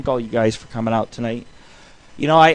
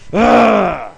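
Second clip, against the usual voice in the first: loud mock grunt of disgust; exaggerated comic groan, loud; 6 semitones higher, 8 dB louder than usual. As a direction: blurt a rough loud ugh as if cake just hit you. After hitting face with cupcake.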